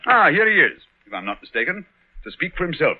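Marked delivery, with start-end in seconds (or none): none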